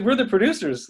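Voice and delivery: high, loud retold retort; mock indignation. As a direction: imitate a producer pulling rank. Retells producer's indignant retort.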